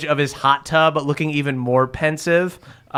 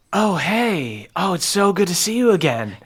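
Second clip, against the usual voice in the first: higher, swooping sing-song dryad voice; gushing dryad; 4 semitones higher than usual. Acted. Dryad spotting Beverly in the forest, high swooping greeting. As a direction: coo a greeting to an old acquaintance. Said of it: "One of these Trisses looks out ... and goes".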